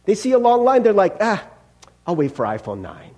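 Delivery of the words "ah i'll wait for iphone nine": dismissive. Acted. Mimics jaded buyers in line.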